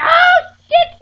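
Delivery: high, loud panicked screams; hollering and screaming. Retold or imitated. Friend hollering over the phone, frantic screams. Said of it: "She said again".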